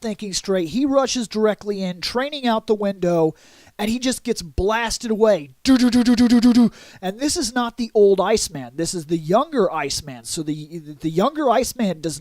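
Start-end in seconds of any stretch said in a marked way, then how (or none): 5.6-6.7 rapid stuttering machine-gun noise; imitated gunfire. Retelling Iceman being blasted away, imitated gunfire. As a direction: rattle off evenly like blaster fire.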